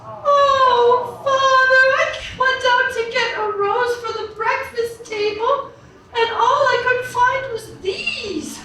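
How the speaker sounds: high, singsong crying princess voice. Acted. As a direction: play the weepy little princess, high and plaintive.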